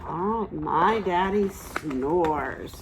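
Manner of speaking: ordinary delivery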